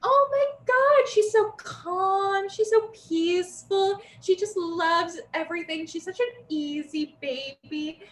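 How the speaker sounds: put-on high, slow, gushing baby talk; cooing adults. Relatives cooing over a calm newborn, gushing and high.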